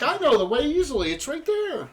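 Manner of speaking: high-pitched confident adventurer; character voice. Acted. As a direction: pitch it up and sound sure. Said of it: "he's like".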